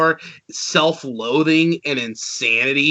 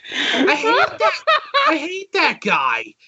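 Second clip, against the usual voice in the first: laughing exasperation; 13 semitones higher, slower than usual. Real, not acted. Ranting about a YouTube critic.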